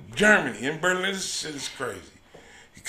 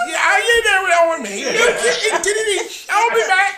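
high, loud, laughing through the quote; 13 semitones higher, 5 dB louder than usual. Retold or imitated. Laughing hard quoting the doctor in the clinic. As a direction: crack up as you quote him.

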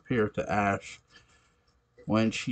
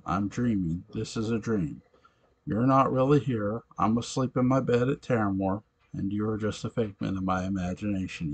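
ordinary delivery

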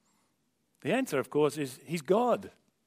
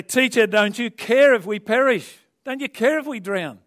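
high, loud desperate plea over the storm; panicked cry; 7 semitones higher, 9 dB louder than usual. Retold or imitated. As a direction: cry out like frightened sailors.

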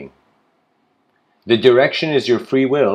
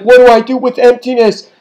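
higher, louder bewildered cry; anxious plea; 9 semitones higher, 8 dB louder than usual. Acted. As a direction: ask the void in alarm. Person voiced in parable.

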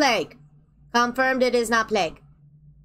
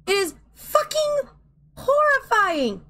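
high whimper; slower than usual. Real, not acted. Squealing, near crying, overwhelmed.

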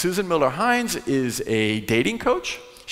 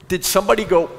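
usual speaking voice

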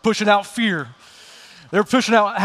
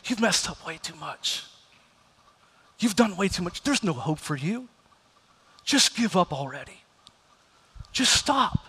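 enemy's whisper; 6 dB quieter than usual. Retold or imitated. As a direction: speak it as a voice of condemnation. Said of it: "He'll whisper these things and say".

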